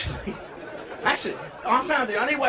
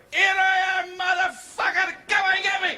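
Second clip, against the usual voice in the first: defiant shout. Pretend. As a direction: bellow a dare at the planes overhead. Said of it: "stand in the middle of the open and say".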